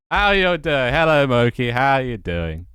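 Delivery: loud, high call easing down; excited greeting. Real, not acted. Streamer welcoming a crowd to the stream, excited. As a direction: hail the newcomers with a big burst.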